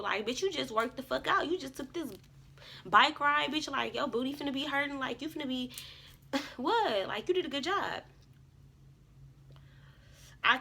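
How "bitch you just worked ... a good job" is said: higher, giddy cheering to herself, laughing partway; hyped self pep talk. Retold or imitated. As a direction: pump yourself up like your own best friend after a ride. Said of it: "feeling good in a good ass mood".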